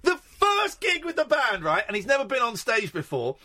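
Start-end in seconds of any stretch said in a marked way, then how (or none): 0.0-1.8 gushing high camp voice; high giddy rock star. Retold or imitated. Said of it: "And he goes out".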